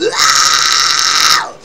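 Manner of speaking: loud sustained scream; loud harsh "ah" ringing in the mouth; head scream. Shown as a demonstration. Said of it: "it's in my mouth".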